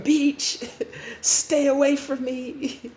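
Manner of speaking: high, drawn-out warning cry; mock yelling. New Restart tells Restart to stay away, yelling. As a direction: cry out to ward him off. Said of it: "new Restart is yelling at his face".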